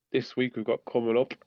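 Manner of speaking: ordinary talk, nothing marked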